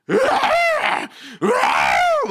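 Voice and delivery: very high, loud screeching roar; imitated monster roar. Pastor mimicking the noise heard in the house, imitated monster roar. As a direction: roar like a monster, full force. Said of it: "괴물 소리가 들려요".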